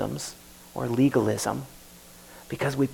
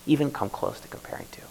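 whispered, softer and softer; closing whisper; 9 dB quieter, faster than usual. Real, not acted. Preacher closing sermon to congregation, whispered. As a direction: breathe the final question out. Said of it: "closing sermon in whisper".

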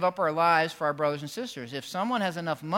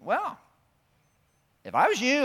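city manager; 9 semitones higher, 6 dB louder than usual. Retold or imitated. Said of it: "she's like".

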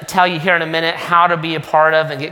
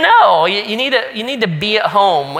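emphatic mock answer; 4 semitones higher than usual. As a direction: tell them firmly but jokingly. Pastor answers family with a laugh.

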